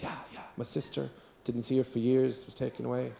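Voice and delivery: soft, low imitated tearful voice; woman in tears. Woman telling of her sister taken away, in tears. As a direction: speak haltingly and very softly, as if tearful. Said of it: "she was there in tears".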